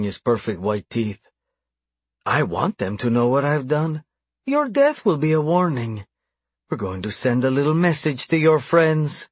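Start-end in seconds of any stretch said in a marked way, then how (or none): 2.2-9.3 higher-pitched villainous gloat; gloating menace. A narrator's reading. Captor promising a message to captive's friends, gloating. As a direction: relish the threat.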